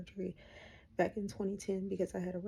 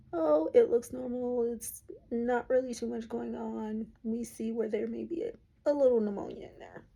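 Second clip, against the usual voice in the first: quoting the doctor; 4 semitones higher, 5 dB louder than usual. Retold or imitated. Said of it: "They did a cat scan Friday".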